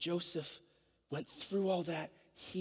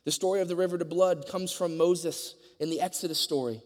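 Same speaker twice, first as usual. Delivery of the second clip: higher, fervent preaching voice; 6 semitones higher, 4 dB louder than usual. Acted. Preaching to the congregation about communion, fervent and raised. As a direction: lift it like a pulpit appeal.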